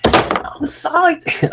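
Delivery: very high, stretched-out excited squeal; Mike Myers. Acted. Creator spoofing a film character, drawn-out giddy squeal. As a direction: squeal it slowly in a silly giddy voice. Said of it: "there's always a Mike Myers ref".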